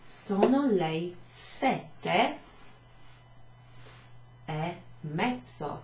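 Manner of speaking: very slow, "sono" drawn out with a rise; slow careful teacher example; slow model phrase. Shown as a demonstration. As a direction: stretch "Sono le sette e mezzo" out. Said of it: "check this out".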